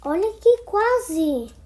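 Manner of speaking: gasp, then slow loud "quase"; nearly solved it, thrilled; excited gasp. Real, not acted. Almost solving the cube.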